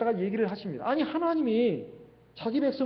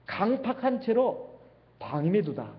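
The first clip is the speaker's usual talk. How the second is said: normal talking voice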